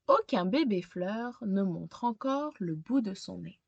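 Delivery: put-on gentle read-aloud narration for a young child; storybook reading. A narrator's reading. Teacher reading a picture book to a child, storytelling voice. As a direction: tell it like a bedtime picture book.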